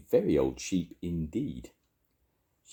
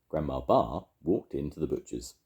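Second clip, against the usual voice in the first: ordinary delivery, nothing marked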